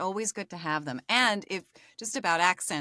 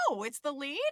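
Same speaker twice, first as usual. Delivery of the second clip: higher, softer mock dismay; 7 semitones higher, 5 dB quieter than usual. Actor learning the role is the lead, mock dismay. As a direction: sink into pretend dread at the news.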